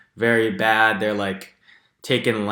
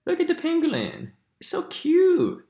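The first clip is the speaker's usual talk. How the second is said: cooing over cuteness. Real, not acted. Gushing over cute animal.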